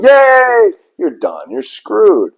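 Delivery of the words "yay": much higher, loud mock cheer; imitating a crowd cheering the heel. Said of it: "the crowd's going".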